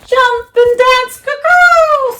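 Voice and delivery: very high, slow crowing rooster. A narrator's reading. Rooster cheering Wilbur on, high crowing. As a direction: crow it like a rooster. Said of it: "said the rooster".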